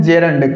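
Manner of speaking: "gerund" said as JEH-rund, e first; correct gerund pronunciation. Shown as a demonstration. Teacher correcting students' pronunciation, gerund said properly as JEH-rund. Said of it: "इसका correct pronunciation है gerund".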